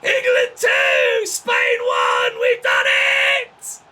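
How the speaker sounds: screaming with joy, laughing between; ecstatic scream. Real, not acted. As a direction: roar it out at the winner. Winning goal celebration, screaming.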